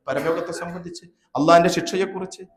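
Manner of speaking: ordinary delivery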